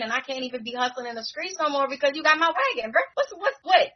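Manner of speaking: put-on exasperated neighborhood kid demanding his wagon back; hustler kid complaint. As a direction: play him fussing over his borrowed wagon. Retelling Landon's wagon complaint.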